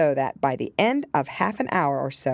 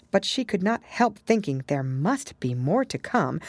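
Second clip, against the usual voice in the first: ordinary delivery, nothing marked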